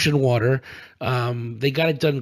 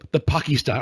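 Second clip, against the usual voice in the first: usual speaking voice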